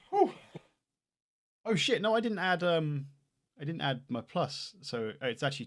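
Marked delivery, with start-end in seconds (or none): none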